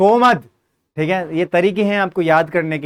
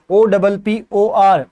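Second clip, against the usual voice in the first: letters spaced out slowly for students to copy; teacher spelling out letters for students to repeat; slow spelling dictation; 4 dB louder, much slower than usual. Shown as a demonstration. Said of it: "जैसे मैं बोल रहा हूँ ऐसे आप भी".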